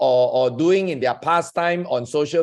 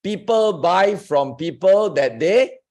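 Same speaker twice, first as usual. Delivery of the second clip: slow prompt, left hanging on a rise; feed the line slowly for someone to finish; slower for dictation; slower than usual. Shown as a demonstration. Host prompting a guest to finish the saying, slowly. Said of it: "say it a bit slower".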